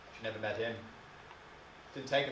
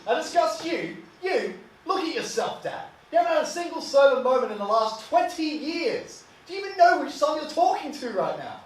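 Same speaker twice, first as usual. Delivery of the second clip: high, loud outburst at a parent; indignant son shouting down his father; furious retort; 13 semitones higher, 7 dB louder than usual. Acted. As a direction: throw the accusation back, voice pitched right up. Son yells back at drunk father.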